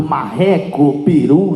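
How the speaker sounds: ordinary delivery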